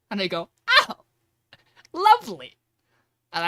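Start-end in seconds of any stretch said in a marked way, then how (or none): 0.7-2.6 very high, loud "lovely" reaction; retold reaction, pitched way up; mock delight. Reacts to news of dragon.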